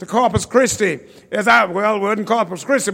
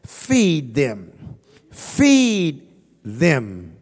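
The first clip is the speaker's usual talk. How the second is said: voice of Jesus; 7 semitones lower, 4 dB quieter, much slower than usual. Acted. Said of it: "Jesus said to the disciples".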